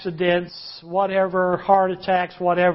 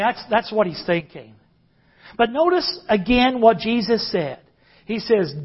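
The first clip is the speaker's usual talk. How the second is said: normal talking voice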